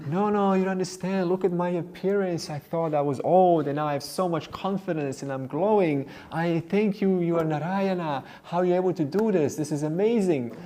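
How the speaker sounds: insist, overflowing with wonder; ecstatic insistence. Acted. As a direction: customer pleading that the barber is divine. Customer insists he is amazed.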